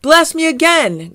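high, loud, eager dare; excited shout. Acted. Garfield to the surprised Cosmots, excited shout. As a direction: shout it out with relish. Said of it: "Garfield shouted".

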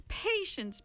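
sing-song high PATIENCE; mock patience. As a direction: Patience drawn up high, chiding. Villain chiding Meg.

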